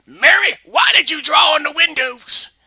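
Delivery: loud, high furious mom; angry shout. Acted. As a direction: yell at the kid.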